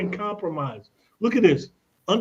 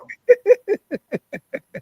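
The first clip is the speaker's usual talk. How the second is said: squealing high cackle; shrill burst of laughter; high mocking laugh; 14 semitones higher, 4 dB louder than usual. Mocking tax guy's verdict.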